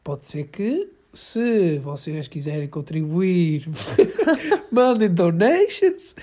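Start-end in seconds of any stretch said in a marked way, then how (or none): 4.7-6.1 laughing. Real, not acted. Joking plea to listeners for money.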